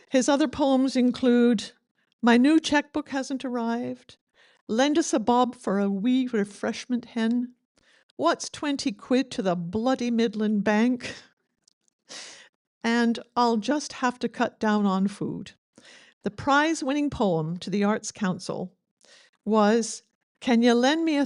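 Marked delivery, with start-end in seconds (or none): none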